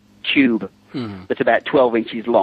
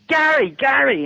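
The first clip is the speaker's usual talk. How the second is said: high-pitched hail across a crowd; imitated calling out; 13 semitones higher, slower than usual. Actor coming down the theatre aisle, high calling out. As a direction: mimic someone calling for attention, pitched way up.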